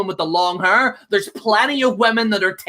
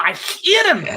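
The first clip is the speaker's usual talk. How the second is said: angry outburst; 7 semitones higher than usual. Real, not acted. Ranting about wrestler.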